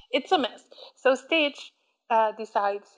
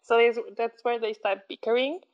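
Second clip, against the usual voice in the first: ordinary delivery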